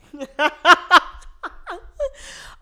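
real laugh cutting off her own sentence; high laugh. Real, not acted. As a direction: burst of high loud laughter.

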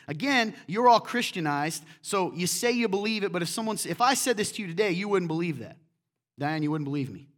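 loud, emphatic sermon delivery; preaching. Acted. Preacher presses congregation to see the story anew. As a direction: drive it home to the pews.

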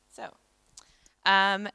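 ordinary talk

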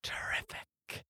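slow, hushed golem rasp; raspy whisper. Acted. Golem accepting the player's bargain, slow raspy whisper. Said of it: "in the same raspy whisper".

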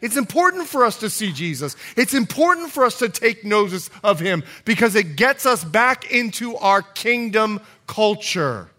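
loud, high preaching voice; impassioned preaching. Real, not acted. Preacher urges church to keep sight on Jesus, impassioned preaching. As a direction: drive it home to the congregation, loud and high. Preacher builds to full force.

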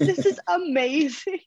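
high, gushing fangirl squeal of delight. Retold or imitated. Athlete recalling getting fitted for her gear, squealing like a fan. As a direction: gush it like a starstruck fan. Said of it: "fangirling inside".

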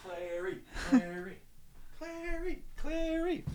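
calling Clary over and over, sing-song and high. Pretend. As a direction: call her name playfully. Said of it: "calling sing-song".

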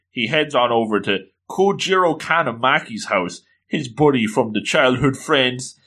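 usual speaking voice

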